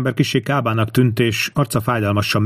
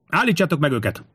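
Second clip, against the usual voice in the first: high narrated cry; shouted order; 6 semitones higher than usual. A narrator's reading. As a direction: order the men to stop them. Tinkomius orders his men to attack.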